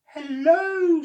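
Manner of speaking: very high, loud friendly creature; octopus voice. Acted. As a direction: greet Oscar cheerfully as the octopus.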